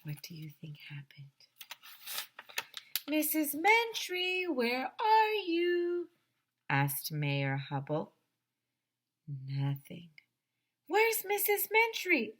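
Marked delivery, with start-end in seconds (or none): 3.1-6.1 high, drawn-out searching call; long, high-pitched holler into the dark. A narrator's reading. Calling out for missing woman. 10.9-12.3 very high, urgent question; high-pitched storybook alarm. A narrator's reading. Characters search for missing woman.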